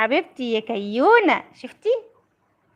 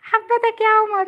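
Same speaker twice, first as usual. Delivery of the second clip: much higher cooing; 9 semitones higher than usual. Real, not acted. Mother telling Omar the girl loves him, high coo.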